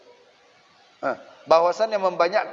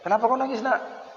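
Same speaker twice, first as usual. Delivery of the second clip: parent asking; 7 semitones higher, 3 dB quieter than usual. Retold or imitated. Parent asks crying child.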